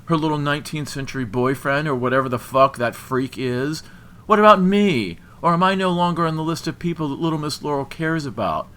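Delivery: loud, high, scornful outburst; voice rising angrily. A narrator's reading. Jilted man ranting about the new boyfriend, angry shouting. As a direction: raise your voice in jealous outrage. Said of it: "his voice rising too".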